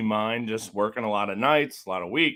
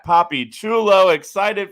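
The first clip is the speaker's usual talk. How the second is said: excited; 8 semitones higher, 9 dB louder than usual. Acted. Reading a fan's shoutout.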